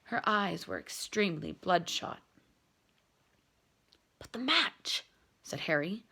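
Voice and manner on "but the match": faint breathy whisper; whispered question. Acted. Injured Harry asking his teammates, whisper. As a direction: barely voice it from the bed. Weak boy in hospital bed.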